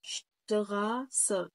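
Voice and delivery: شتراسه very slow, sht at the start; German word demo; German sht sound. Shown as a demonstration. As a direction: model شتراسه slowly. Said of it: "بايشبيل كلمه شتراسه".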